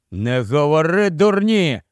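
ordinary delivery, nothing marked

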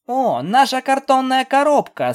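high-pitched, delighted realization; giggly delight. Acted. Pegasus guessing what the stallion travels with, high and gleeful. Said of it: "Скуталу хихикнула".

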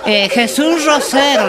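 high, loud, slow mock cry; mock shout. Argentino cheering himself on, loud and high. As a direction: belt it out like a proud fan. Joke punchline shouted in character.

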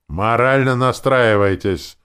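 loud, slow growled order; orc growl. A narrator's reading. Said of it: "прорычал орк".

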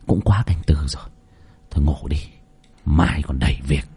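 low, soft musing to himself; quiet inner thought. A narrator's reading. Nam thinking to himself before sleep, low and soft. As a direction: think it through half asleep, softly. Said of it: "vắt tay lên trán mà nghĩ".

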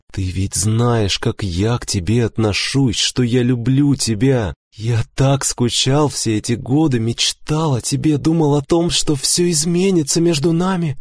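earnest plea, climbing steadily in pitch; pleading confession. A narrator's reading. Husband confessing years of longing to wife, pleading.

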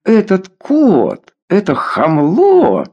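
put-on high, drawn-out drunken jeer; slow, high-pitched scornful outcry; scornful exclamation. A narrator's reading. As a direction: stretch it out in outraged mockery. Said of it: "воскликнул Ворона".

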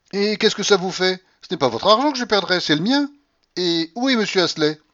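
very high, loud rebuke; indignant old grandmother. Acted. Grandmother tells them it's her money, indignant. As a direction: lash back, loud and high.

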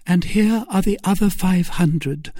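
high-pitched Milady voice. Acted. Milady paying the captain, high-pitched. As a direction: offer the gold in a high voice. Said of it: "replied Milady".

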